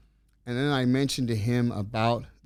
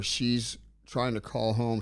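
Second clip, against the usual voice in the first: usual speaking voice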